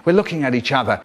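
ordinary delivery

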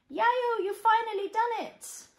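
loud, high excited cheer; cheering shout. Acted. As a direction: shout it out in celebration.

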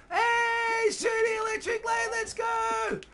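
very high, loud whooping greeting; high-pitched excited holler; hyped shout-out. Real, not acted. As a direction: whoop a supporter's name in celebration. Greets a supporter on stream.

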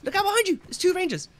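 high, loud warning yell; alarm shout. Real, not acted. Warning of enemies behind.

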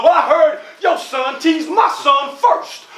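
high heated shout; mother shouting back in a playground quarrel; arguing mother. Acted. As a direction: fire back at the other mom.

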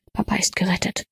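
ordinary delivery

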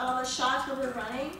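ordinary delivery

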